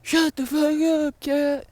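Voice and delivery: high, loud mock yell at the cat. Husband yelling at the cat, mock loud. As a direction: blurt it out like an angry sleepy husband. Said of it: "And he's like".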